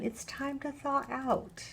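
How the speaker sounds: put-on slowly and softly, like a kind old lady soothing her; gentle old lady. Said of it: "She said".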